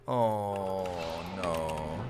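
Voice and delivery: sinking low and soft, oh no; dreading groan. Retold or imitated. Tired narrator at the lion's plan, low dread. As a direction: let it drop into weary dread.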